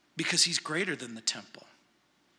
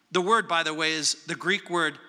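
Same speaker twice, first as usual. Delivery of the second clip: normal talking voice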